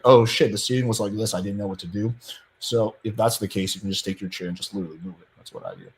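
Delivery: normal talking voice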